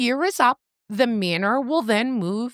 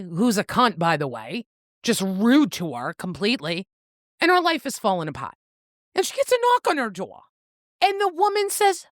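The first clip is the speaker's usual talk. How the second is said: ordinary talk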